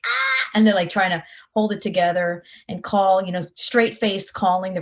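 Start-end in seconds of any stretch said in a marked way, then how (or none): 0.1-0.2 put-on excited little-girl squeal; girlish squeal. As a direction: squeal like a ten-year-old fan. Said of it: "a ten-year-old girl, be like".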